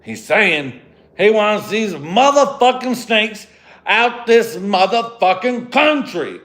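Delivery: high, loud, slow and emphatic tough-guy line; hammed-up action-hero delivery; Samuel L. Jackson impression. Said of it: "Samuel Jackson drops and says".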